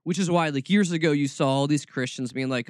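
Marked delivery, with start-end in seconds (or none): none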